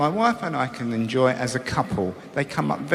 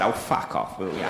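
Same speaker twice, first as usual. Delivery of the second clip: hushed retort; 11 semitones lower, 6 dB quieter than usual. Retold or imitated. His own retort, hushed.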